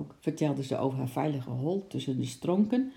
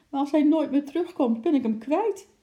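high, loud tearful wail; half-crying protest; 11 semitones higher, 6 dB louder than usual. A narrator's reading. Girl fearing she loses her pet, half-crying. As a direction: cry out as if near tears. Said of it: "riep Lotje half huilend".

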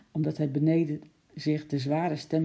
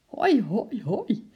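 put-on higher, whooping boyish cheer; exuberant cheer; 6 semitones higher, 3 dB louder, much slower than usual. A narrator's reading. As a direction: whoop with glee. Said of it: "riep Henkie uitgelaten". (uitgelaten juichend)

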